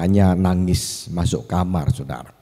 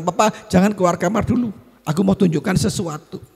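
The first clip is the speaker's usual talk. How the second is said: put-on high-pitched childlike voice; acted child with something to show; eager child; 13 semitones higher than usual. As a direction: ask Dad to wait, pitched way up. Child stops father leaving room.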